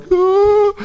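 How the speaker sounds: high, loud startled "ah"; mock gasp of fright. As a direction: yelp at the edge of the canyon. Retelling fear at the canyon.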